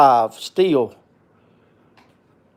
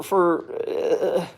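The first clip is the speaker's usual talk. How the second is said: usual speaking voice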